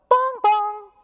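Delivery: high loud bong, bong like a chime; doorbell imitation. Pastor mimics the doorbell interrupting the cleanup, chiming. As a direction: ring it out like a doorbell.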